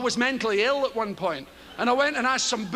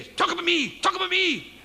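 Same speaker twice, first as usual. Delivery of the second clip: a pushy little voice in the head, higher; nagging inner voice; 6 semitones higher than usual. Acted. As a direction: whine it like a needy idea demanding attention. Idea nagging him inside head.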